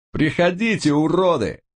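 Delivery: высокий отчаянный ор со страхом; ори от страха и ярости; яростный крик. A narrator's reading. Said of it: "проорал в ответ Блэк". (крик со страхом и яростью)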